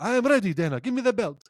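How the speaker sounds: high, eager mock Khabib demanding the belt. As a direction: pitch it up and push for the belt. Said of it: "He's like".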